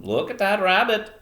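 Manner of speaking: put-on old fisherman with a Maine accent; high, loud attempt at Down East Maine; Eastern Maine accent. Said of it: "trying to do an Eastern Maine accent".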